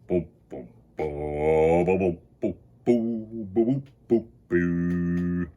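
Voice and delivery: low booming tuba sounds; deep mock tuba oom-pahs; tuba booms. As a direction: boom out like a tuba.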